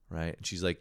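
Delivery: ordinary delivery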